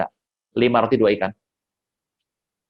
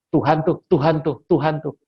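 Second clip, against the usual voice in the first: ordinary talk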